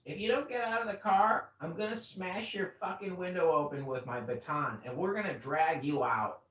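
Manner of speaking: high, loud threat, imitating the officer; threatening cop. Retelling the officer threatening to smash the window, loud mock threat. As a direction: threaten him like an angry cop. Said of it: "she goes".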